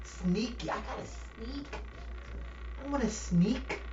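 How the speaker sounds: high, slow, sugary cheater voice; mock sweet talk.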